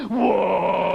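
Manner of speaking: long, loud, drawn-out whoa of alarm. Retold or imitated. Comic showing the London warning cry, drawn-out whoa. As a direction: hold the whoa like a warning horn. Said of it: "it's like".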